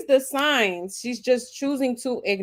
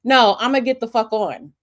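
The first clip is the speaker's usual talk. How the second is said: ordinary delivery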